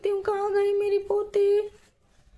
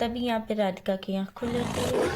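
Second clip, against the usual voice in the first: story narration; 7 semitones lower than usual. A narrator's reading. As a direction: narrate the scene calmly and low.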